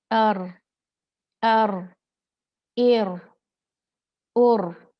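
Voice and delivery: loud, long rolled अर, एर, उर; overdone trill. Shown as a demonstration. Teacher showing the wrong, forced trill. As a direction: roll the r too hard. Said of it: "ये ऐसे नहीं है".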